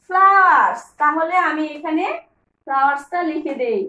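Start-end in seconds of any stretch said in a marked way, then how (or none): 0.1-0.8 loud, clear teacher model of flowers; teacher's model word. Shown as a demonstration. Teacher showing children the word, loud modelling. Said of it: "এগুলি কি?".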